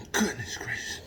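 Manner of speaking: breathy whisper under his breath; whispered exasperation. Real, not acted. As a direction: whisper it, fed up. Exasperated aside to himself.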